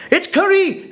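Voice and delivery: high, lively call across the room; retold shout. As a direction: announce the dish over the party noise. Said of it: "shouts this woman".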